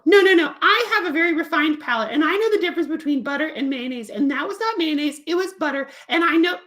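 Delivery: high, haughty cat puppet. Acted. Cat puppet boasts about refined palate, high and haughty. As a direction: boast like a fussy gourmet kitty.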